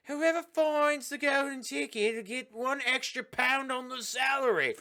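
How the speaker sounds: hammed-up factory boss addressing workers; boss impression. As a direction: proclaim it in a high mock voice. Acts out boss announcing to workers.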